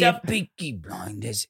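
low, slow put-on British gangster accent; Peaky Blinders. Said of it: "watching too much Peaky Blinders".

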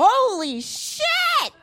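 high, very slow astonished gasp; high drawn-out shocked announcement; mock host reveal. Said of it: "rip open an envelope and go".

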